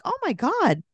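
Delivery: high, gushing put-on voice; hammed-up amazed dad; mock Andrew voice. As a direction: gush it out in a silly high voice. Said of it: "He's like".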